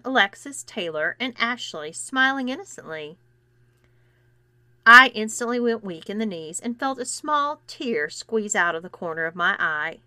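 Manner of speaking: usual speaking voice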